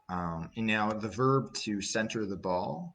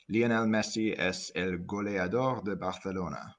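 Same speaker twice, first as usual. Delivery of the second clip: Spanish sentence. Shown as a demonstration. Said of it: "which would be".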